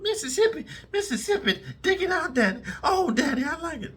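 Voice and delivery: high falsetto moaning with laughing breaks; mocking falsetto moan. Mocking inmates with a high falsetto moan. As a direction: camp it up high and whimpering.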